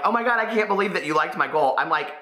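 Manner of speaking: normal talking voice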